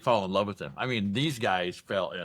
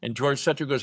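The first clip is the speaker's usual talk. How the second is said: ordinary talk, nothing marked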